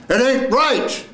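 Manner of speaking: very high, slow cry; full-out acted line. Actor ending the first scene, high drawn-out cry. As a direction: throw it up high and hold it.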